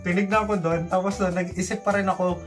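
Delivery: ordinary talk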